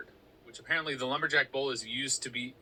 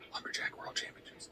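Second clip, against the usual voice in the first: whisper; 10 dB quieter than usual. Real, not acted. Whispered expansion.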